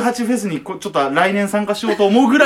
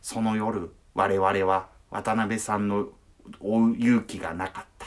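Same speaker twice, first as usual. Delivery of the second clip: low, hushed, grave documentary narration; slow, somber closing voiceover; documentary narrator voice; 9 semitones lower, 6 dB quieter, slower than usual. Retold or imitated. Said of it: "最後の締めが".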